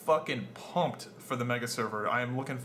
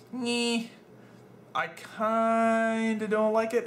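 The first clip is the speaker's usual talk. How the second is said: whiny; 8 semitones higher than usual. Real, not acted. Grudging complaint about forced names.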